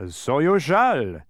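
high, loud, slow announcement; announcing. A narrator's reading. Dodecahedron granting Milo's wish, grand announcement. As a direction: proclaim it, stretching each word. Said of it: "announced the dodecahedron".

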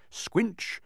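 squinch jumped up high. A narrator's reading. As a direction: squinch said squeaky high like a wet step. Footstep sound effect.

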